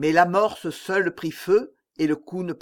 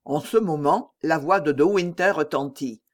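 usual speaking voice

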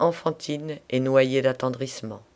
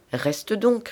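higher-pitched, mildly alarmed urging; a touch of fright, pitched up; frightened plea; 7 semitones higher than usual. A narrator's reading. Satin begging a friend not to jump, frightened. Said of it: "répétait Satin effrayé".

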